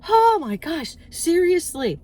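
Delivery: excited gush. Real, not acted. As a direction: gush about it. Gushing about online groceries.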